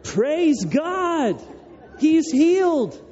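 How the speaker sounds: very high, hammed-up jubilation; ecstatic celebration. Crowd celebrates the blind man's healing, mock ecstatic. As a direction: act out an overjoyed crowd, voice soaring. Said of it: "they are ecstatic".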